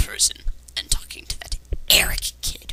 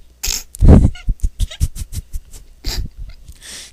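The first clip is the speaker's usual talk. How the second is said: a laugh that shoots way up and gets louder; shrieking laugh at the narrator's claim; high shrieking laugh; 6 dB louder than usual. Real, not acted. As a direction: cackle out loud at the line. Laughs at the girls remark.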